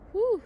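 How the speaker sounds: loud, high exaggerated whew of exhaustion; a hammed-up whew as if worn out; mock tired whew. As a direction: blow out a big pretend-tired whew. Reacting to hours of chewing.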